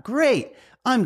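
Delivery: Great popped way up high in delight; mock excited. Recalling his joke reaction to a loan letter, mock thrilled. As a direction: act thrilled at the junk-mail loan offer.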